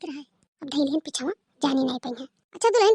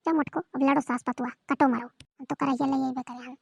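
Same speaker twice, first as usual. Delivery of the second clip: sly aside; 6 semitones lower, 6 dB quieter than usual. Acted. Gleeful aside while others quarrel.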